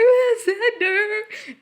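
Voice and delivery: very high, drawn-out swoon; mock lovesick wail. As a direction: wail the name in exaggerated longing. Mimics pining for Xander.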